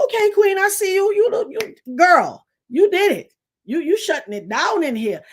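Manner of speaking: very high excited praise; hype compliment. Retold or imitated. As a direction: gush over her look. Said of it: "I said".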